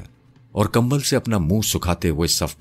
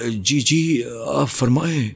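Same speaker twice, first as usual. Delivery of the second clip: high-pitched, servile and frightened; cringing plea; 8 semitones higher, slower than usual. A narrator's reading. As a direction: grovel nervously before someone menacing. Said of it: "اکبر کھکیا کر بولا". (کھکیا کر، گھبرائی آواز میں)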